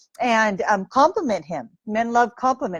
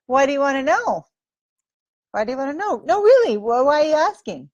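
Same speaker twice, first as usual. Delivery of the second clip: higher, teasing dodge of his question; coy; 4 semitones higher than usual. Shown as a demonstration. Woman on a date dodging his question, coy and higher. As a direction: play coy and tease him a little. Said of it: "a little coy".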